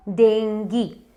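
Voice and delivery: डेंगी ending in "ee"; correct English pronunciation. Shown as a demonstration. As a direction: say डेंगी as "deng-gee". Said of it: "correct pronunciation English में है". (डेंगी के अंत में "ई" बोलें)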